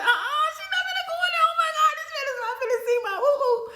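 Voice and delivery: very high, loud squeal through laughter; hammed-up shrieking refusal, laughing; comic panic. Voicing patient's comic panic.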